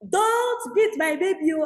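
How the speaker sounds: high, loud, drawn-out mock shout. Sister protecting her baby from the angry aunt, shouting. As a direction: imitate an alarmed mother shouting in protest. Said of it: "My sister was shouting".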